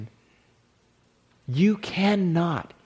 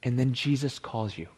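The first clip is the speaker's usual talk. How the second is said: guided meditation; 3 semitones lower than usual. Acted. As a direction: guide the listeners inward, hushed. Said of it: "lead us in a bit of an exercise".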